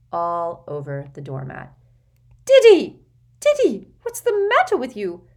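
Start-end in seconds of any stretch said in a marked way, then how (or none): 2.5-5.3 high panicked shriek; shrieking Aunt Petunia. Acted. Petunia shrieks at sick son.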